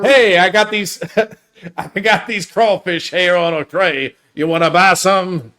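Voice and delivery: Cajun backwater guy. Acted. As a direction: call out in a mock Cajun backwater accent. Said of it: "like, a Cajun backwater guy".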